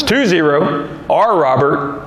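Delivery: "It's two zero R Robert" slowed right down, each piece spaced out; slow, spelled out. Real, not acted. To the confused rental agent, patient slow spelling. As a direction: talk slowly, as if to someone who isn't getting it. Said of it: "I'm gonna make it simple for you then".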